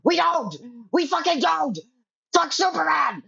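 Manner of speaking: rising into a high furious yell; ranting at the fans, climbing to a high angry yell; building rant. Real, not acted. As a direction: work yourself up until it bursts.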